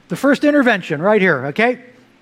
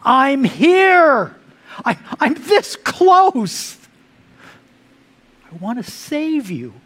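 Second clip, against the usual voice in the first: God calling out; 6 semitones higher, much slower than usual. Acted. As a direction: call out urgently, rising high on "close". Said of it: "he screams at us in our pains".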